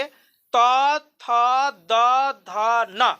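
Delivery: very slow, each letter held apart; slow letter-by-letter demonstration; slow demo recitation. Shown as a demonstration. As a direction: sound out each letter slowly. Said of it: "फिर से देखो एक बार पढ़ के".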